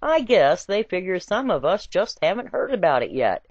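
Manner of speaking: usual speaking voice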